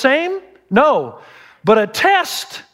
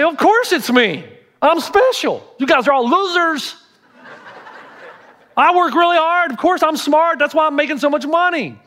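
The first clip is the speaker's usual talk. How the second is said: higher, puffed-up bragging voice; mock self-important boasting, pitched up; arrogant boast; 7 semitones higher than usual. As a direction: play the smug rich guy mocking everyone. Imagined arrogant rich believer.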